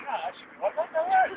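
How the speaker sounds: easygoing bloke, last words jump high; breezy no-worries mate; casual Aussie bloke. Retold or imitated. Tour guide voicing naked Aussie diggers, jokey and carefree. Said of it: "Just going".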